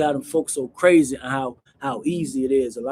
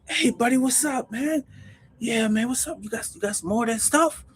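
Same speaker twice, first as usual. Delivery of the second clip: higher, keen buyer voice; eager morning customer; 8 semitones higher than usual. Retold or imitated. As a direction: greet him like you want more. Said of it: "buddy out there like this".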